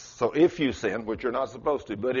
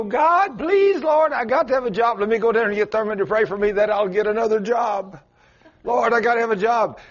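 high, loud frantic begging; desperate pleading; 9 semitones higher, 4 dB louder than usual. Acted. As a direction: beg God as if your life depends on it. Desperate begging prayer to God.

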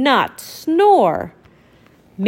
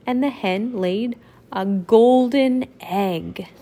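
plain reading voice

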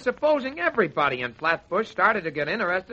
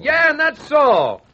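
mock umpire comeback; 8 semitones higher, 6 dB louder, slower than usual. Mocking back the umpire retort.

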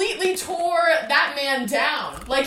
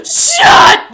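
shocked shout; 17 dB louder than usual. Real, not acted. Gasps at surprise guest.

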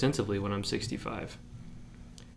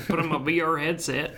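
put-on old prospector; 6 semitones higher than usual. Mocking old gamer.

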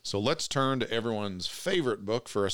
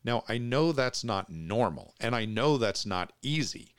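ordinary delivery

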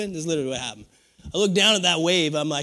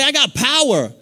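call and response; 6 semitones higher, 4 dB louder than usual. Pretend.

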